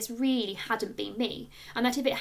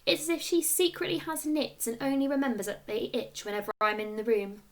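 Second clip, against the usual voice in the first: usual speaking voice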